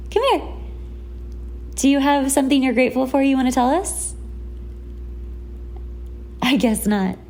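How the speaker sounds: ordinary delivery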